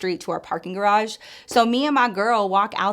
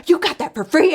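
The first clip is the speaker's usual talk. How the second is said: high, loud disbelieving ask; amazed question; 8 semitones higher, 6 dB louder than usual. Retold or imitated. Coworker hears it was free, amazed high question. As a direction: coworker can't believe it.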